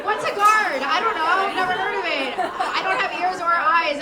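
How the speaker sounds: loud, high burst of giddy denial; mock obliviousness. Smitten dater abandoning her guard, in loud mock obliviousness. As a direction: throw all caution away, high and loud.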